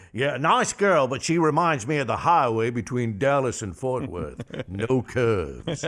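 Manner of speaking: Foghorn Leghorn voice. Acted. Retold Foghorn joke.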